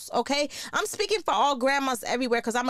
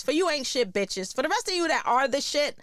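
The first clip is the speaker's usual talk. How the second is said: ordinary talk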